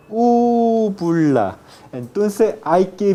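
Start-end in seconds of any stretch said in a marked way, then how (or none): 0.1-1.6 slow loud "úvula"; stretched word. Shown as a demonstration. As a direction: draw out "úvula" for the class. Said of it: "se llama la". ("úvula" lento y marcado)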